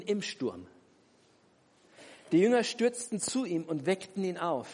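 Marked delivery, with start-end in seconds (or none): none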